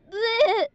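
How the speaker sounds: high loud retching blech; hammed-up gagging noise; loud fake gag. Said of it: "barf along the way".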